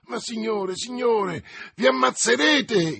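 high, loud panicked plea; terrified servant shouting a warning; frightened cry. A narrator's reading. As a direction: beg him not to jump. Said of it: "gridò Planchet".

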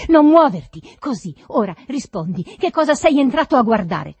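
loud, high, commanding outburst; furious yelling. A narrator's reading. Master halts and questions his servant, furious yelling. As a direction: bellow orders, then demand an answer. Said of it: "urlai correndo verso di lui".

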